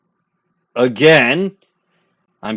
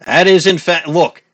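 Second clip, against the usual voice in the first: indignant protest; 3 semitones higher, 6 dB louder than usual. Real, not acted. Indignant at badger claim.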